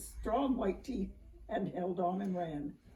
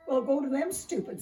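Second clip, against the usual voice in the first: loud, higher exasperated push; impatient snap; 6 semitones higher, 6 dB louder than usual. A narrator's reading. Pine tree losing patience with the dog, loud. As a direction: shove him on impatiently.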